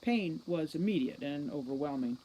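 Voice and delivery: ordinary delivery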